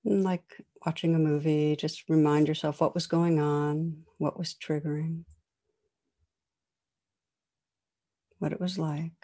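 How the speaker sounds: soft spoken reflection prompt; guided meditation. Acted. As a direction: guide listeners through calm reflection.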